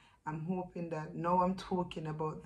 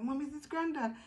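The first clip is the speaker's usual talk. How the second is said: higher, lightly voiced child asking; son asking; 9 semitones higher than usual. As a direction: play the curious little boy. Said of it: "he was like".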